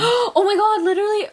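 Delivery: high, loud excited gasp. Real, not acted. Gasping as a friend mentions prison phones. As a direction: gasp with recognition.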